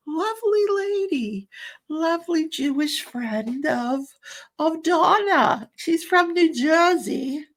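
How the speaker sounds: a high, slow, hammed-up New Jersey accent. Describing Donna's friend from New Jersey in a mock New Jersey accent. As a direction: lean into a playful New Jersey voice, slowly. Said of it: "oh, I'm terrible at accents".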